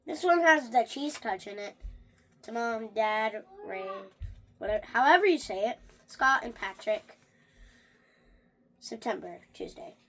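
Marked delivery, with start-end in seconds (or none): none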